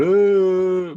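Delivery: very high, loud imitated yell; mock a scream from a movie. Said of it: "Silence of the Lambs guy when he's going".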